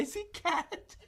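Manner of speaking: words squeezed out through high helpless laughter; can barely get it out for laughing, slow and high; laughing hard. Real, not acted. Telling a friend the funny story, breaking into high laughter.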